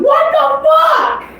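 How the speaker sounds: shouted what the fuck. Retold or imitated. Player shouting at the table when her mom's double leaves, a high slow yell. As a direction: blurt it out in shock, at full volume. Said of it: "I'm at the table going".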